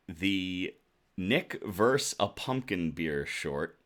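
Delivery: ordinary delivery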